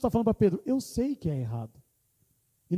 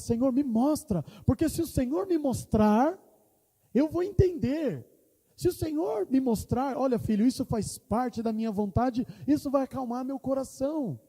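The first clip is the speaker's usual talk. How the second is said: pleading prayer; 7 semitones higher than usual. Retold or imitated. Retold pleading prayer to God.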